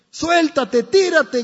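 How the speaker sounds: high, loud shouted urging; crowd shouting. Acted. Onlookers below shout up to the boy, high and loud. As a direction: yell up from the street to jump. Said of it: "gritaban otras voces".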